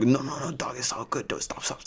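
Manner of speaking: quiet imitation of a homie's accent; Chris. Classmate telling him to stop burning it, soft homie accent. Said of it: "I'm not just doing this accent".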